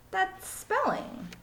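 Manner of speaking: slow, high correction; matter-of-fact kid. Acted. Allison corrects her teacher, slow and high. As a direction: set the teacher straight slowly. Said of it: "said Allison".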